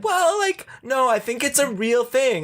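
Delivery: high-pitched mimicry of insistent people; exaggerated high voice, mocking their argument; mock naive believers. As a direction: squeal it like a gullible fan protesting. Mimics people insisting it was real.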